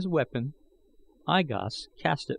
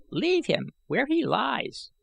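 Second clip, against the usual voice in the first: high, slow aged advice; calm the younger man down; old man counseling; 11 semitones higher, slower than usual. A narrator's reading. Old man counseling Gahan, high and slow. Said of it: "counseled I Gos".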